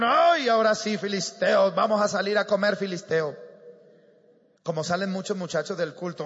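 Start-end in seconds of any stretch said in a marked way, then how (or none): 0.0-3.4 mild mock battle cry; imitated boastful shouting. Preacher acting out Israelites shouting at Philistines, mock battle cry. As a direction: crow lightly like an overconfident army. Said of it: "gritaron y dijeron".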